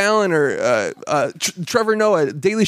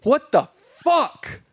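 scolding a TV production, indignant and higher; incredulous rant; 7 semitones higher than usual. Retold or imitated. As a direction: drag out the last words in disbelief. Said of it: "And I was just like".

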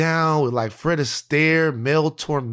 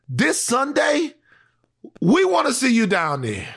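calling out; 9 semitones higher than usual. Real, not acted. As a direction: summon him to the neighborhood. Calls out Rick Caruso.

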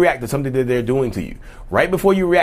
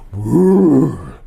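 loud drawn-out demonic whoosh; demon erupting; 6 dB louder than usual. Acted. Demon coming out of a provoked partner, loud eruption noise. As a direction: let out a loud rising "whoo". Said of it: "the demon come out".

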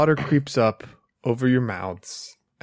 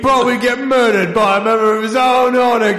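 louder, higher harsh father voice; stern disapproving father; 7 semitones higher, 4 dB louder than usual. Acted. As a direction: berate him like a cold father. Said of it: "Can you be my father who's telling me bad things?".

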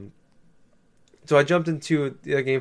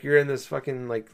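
ordinary talk, nothing marked

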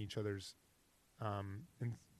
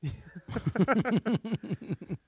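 big burst of laughter; loud laugh; 9 semitones higher, 8 dB louder than usual. Real, not acted. Laughing at OkCupid joke.

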